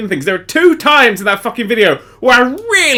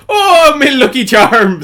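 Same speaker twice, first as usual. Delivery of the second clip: put-on slow laughing Irish accent; a hammed-up Irish leprechaun; 7 semitones higher, 4 dB louder, much slower than usual. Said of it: "It's Irish pirates".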